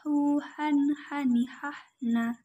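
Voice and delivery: breathy "han, hani" with a swing; swinging recitation. Shown as a demonstration. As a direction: carry the h from the throat. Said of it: "gabungkan dengan ayun-ayun".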